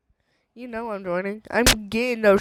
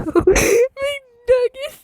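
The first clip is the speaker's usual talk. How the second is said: 1 laughing; 12 semitones higher, 5 dB louder than usual. Real, not acted. Laughing while ordering food.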